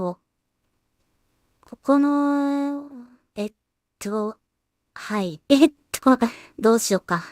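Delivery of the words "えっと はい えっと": ordinary talk, nothing marked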